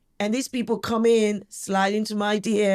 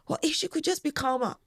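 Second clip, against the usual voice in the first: higher, softer mock critic; mimicking a critic in a raised voice; disapproving critic; 7 semitones higher, 4 dB quieter than usual. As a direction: imitate a prim plea to calm down. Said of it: "people who go".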